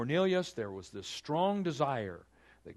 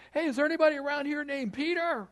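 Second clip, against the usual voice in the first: much higher, loud call at the gate; 6 dB louder than usual. Acted. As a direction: shout to whoever is inside the house. Messengers calling at the gate.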